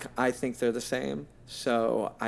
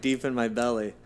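ordinary talk, nothing marked

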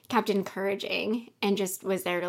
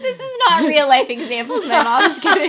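loud, high sarcastic protest through laughter; laughing sarcasm; 8 semitones higher, 12 dB louder than usual. Real, not acted. Daughter teasing mom about her real life examples, laughing sarcasm. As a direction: tease sarcastically while cracking up, raised voice. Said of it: "I was being sarcastic".

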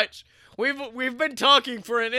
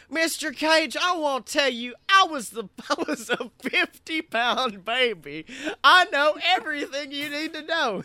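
put-on loud, higher-pitched caricature Alabama accent; Bubba's Alabama accent; 5 semitones higher, 5 dB louder than usual. Bubba boasting to Mister Cage, exaggerated Alabama accent. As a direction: ham up a loud Alabama local. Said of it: "Bubba came in and was like".

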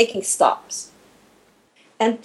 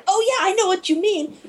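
much higher, bright quick yeah of recognition; eager agreement; 10 semitones higher than usual. Retold or imitated. To the interviewer, a high eager knowing yeah. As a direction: play the nodding listener who gets it. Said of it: "you're going".

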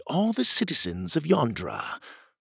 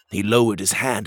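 ordinary delivery

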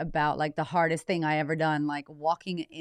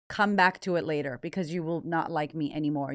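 ordinary talk

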